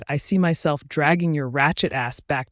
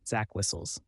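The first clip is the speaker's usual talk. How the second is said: plain reading voice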